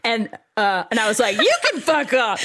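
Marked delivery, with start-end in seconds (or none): 1.4-2.4 high, laughing outburst; mock furious retort. Woman snapping back at pleading Jason, mock furious. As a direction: blurt it with comic rage.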